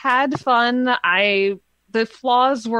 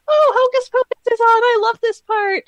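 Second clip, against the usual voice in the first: high-pitched, louder burst of delight; excited exclamation; 13 semitones higher, 5 dB louder than usual. Retold or imitated. Trick-or-treaters spot Hocus Pocus on at the door, excited. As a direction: squeal it like a thrilled movie fan.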